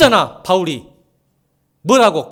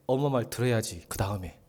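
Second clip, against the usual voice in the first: ordinary talk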